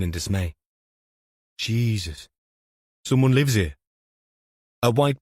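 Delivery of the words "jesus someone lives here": a copper gaping at a wreck; dismayed detective voice. Acted. KFC dismayed at the house.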